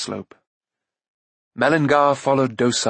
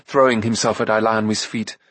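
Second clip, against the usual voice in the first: ordinary delivery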